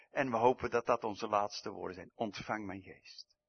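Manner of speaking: ordinary delivery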